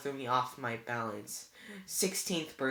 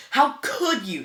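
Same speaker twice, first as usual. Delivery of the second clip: much higher and louder, near a shout; furious cry; 10 semitones higher, 13 dB louder, slower than usual. A narrator's reading. Annabeth confronting Luke about the poisoned tree, furious. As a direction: explode at the betrayal. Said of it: "Annabeth sounded so angry".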